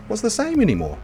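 ordinary delivery

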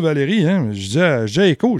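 ordinary delivery

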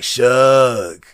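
low, understated voice; Moxley's real talk. Retold or imitated. Wrestler rolls up before the hotel, low imitated voice. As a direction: drop low for a laid-back greeting. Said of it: "That's how he really talk".

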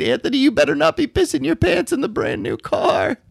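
high, shrill-pitched mock scream of a wife. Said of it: "She's, like, screaming".

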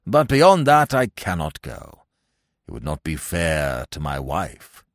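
put-on low, ponderous old man's voice; regretful pompous gentleman. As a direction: refuse solemnly, deep and formal. Old Little regretfully refuses.

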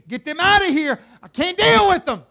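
loud, high, fed-up shout; exasperated outburst. Acted. God ordering Moses to get them out, shouting exasperated. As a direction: explode as God at the end of his patience.

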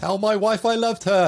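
loud, high last words of a dying man; dying soldier. Pretend. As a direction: ham up a soldier's final request.

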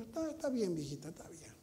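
soft, dismissive soothing to the wife; soft soothing reassurance. Retold or imitated. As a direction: gently placate the wife. Soothing his wife in retold scene.

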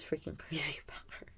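laughing whisper about him. Real, not acted. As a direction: breathe it out with a laugh.

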